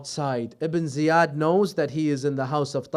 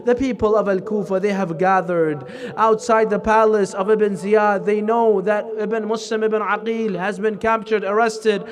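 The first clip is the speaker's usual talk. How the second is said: mourning elegy; 4 semitones higher, 7 dB louder than usual. Acted. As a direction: preach it as a rising lament for the martyr. Preacher builds into elegy recitation.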